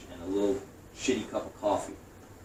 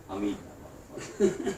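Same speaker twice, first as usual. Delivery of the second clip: laughing; 4 dB quieter than usual. Real, not acted. Laughs at own defiance.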